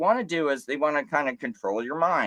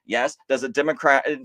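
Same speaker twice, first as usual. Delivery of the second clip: ordinary delivery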